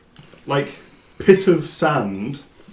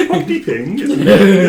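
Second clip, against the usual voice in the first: high-pitched mock Welsh lilt; amateur, jokey Welsh accent; attempted Welsh accent; 5 semitones higher, 7 dB louder than usual. Shown as a demonstration. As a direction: try out a stock Welsh phrase. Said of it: "That's about all I got".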